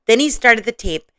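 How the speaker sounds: ordinary delivery, nothing marked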